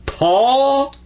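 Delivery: "tall" as a long "taw" climbing high. Shown as a demonstration. Teacher corrects the student, "tall" drawn out without L. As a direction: drop the L from "tall" and stretch it. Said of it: "Don't say the L on it, but say".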